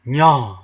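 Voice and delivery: nya with a sharp loud peak. Shown as a demonstration. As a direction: nya ostro, głośno. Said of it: "you can say a word like".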